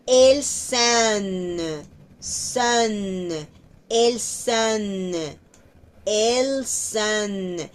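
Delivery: "Elles sont" very slowly, words kept apart; slow, no liaison. Shown as a demonstration. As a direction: keep "Elles" and "sont" separate. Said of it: "Aquí no hay liación". ("Elles sont" lento y separado)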